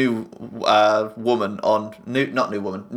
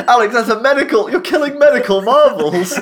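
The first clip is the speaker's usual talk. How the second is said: mock outrage; 9 semitones higher, 4 dB louder than usual. Real, not acted.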